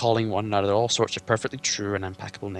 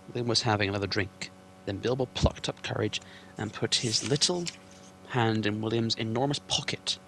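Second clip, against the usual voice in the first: usual speaking voice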